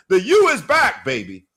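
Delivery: high, loud cheer; excited shout. Real, not acted. Host celebrating the Hurricanes' return with a loud high shout. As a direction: cheer it out like a fan. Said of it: "celebrating the team".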